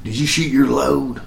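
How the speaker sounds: a soft, mocking imitation of the old actor; R G Armstrong impression. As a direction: quietly parody him asking the question. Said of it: "I hate the way that guy talks".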